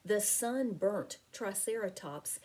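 usual speaking voice

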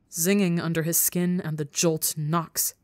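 ordinary delivery

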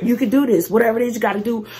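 ordinary delivery

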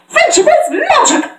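very high cartoon proclamation; Twilight. Twilight declaring their element, high exuberant voice. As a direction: squeal it out as a pony motto. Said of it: "Hi, I'm Twilight".

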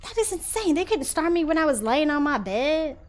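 high, loud, indignant complaint; outraged. Real, not acted. Streamer rants at the game for sending him back. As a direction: let the outrage burst out high. Angry at respawn point.

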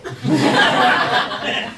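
airy rising giggle; breathy laugh. Acted. Teasing laughter after wagon wish.

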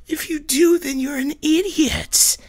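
mock him in a high squeal. Mirror self jeering at Mars, high mocking squeal. Reflection mocks.

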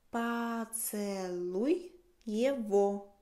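Поцелуй его drawn out very slowly; very slow model. Shown as a demonstration. (muy despacio, Поцелуй его)

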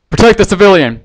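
captain calling to his guards; loud order. Acted. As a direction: shout it over the panic.

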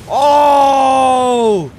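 sudden loud gasp of surprise; surprised shout. Real, not acted. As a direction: caught off guard, shouting. Surprise at new power.

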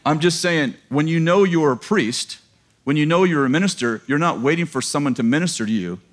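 ordinary talk, nothing marked

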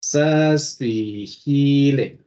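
very slow, stretched syllables while typing; drawn-out words. Real, not acted. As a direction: sound out the command as you type it. Retyping the command slowly, aloud.

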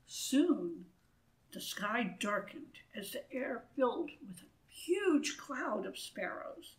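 plain reading voice

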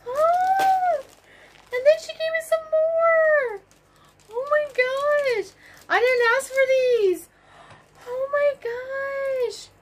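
very high-pitched, overwhelmed excitement; high, gushing disbelief; amazed squeal. Real, not acted. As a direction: squeal it, stunned by an unexpected gift. Amazed at unexpected extra yarn.

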